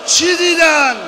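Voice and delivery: loud, high lament preached at full force; wailing mourning sermon. Acted. Preacher wailing Hajar's grief to the mourners, loud and high. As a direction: cry the story out to the mourners.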